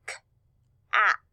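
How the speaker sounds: "K-at" split into k, short a, t; sounded out. Shown as a demonstration. Teacher asking class to listen, sounded out. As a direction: say "K-at" sound by sound, long gaps between. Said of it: "put those sounds together, we hear".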